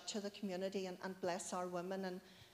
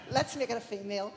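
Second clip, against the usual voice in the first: said through laughter, louder; laughing joke; 7 dB louder than usual. Real, not acted. Joking to the church about the Holy Spirit, laughing. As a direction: laugh the words out.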